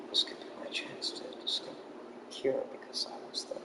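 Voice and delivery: hushed secret thought; whisper. Retold or imitated. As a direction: whisper it like a private plan. Doctor's thought voiced as whisper.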